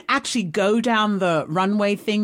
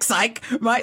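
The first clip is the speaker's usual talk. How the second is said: usual speaking voice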